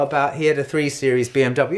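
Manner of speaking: ordinary talk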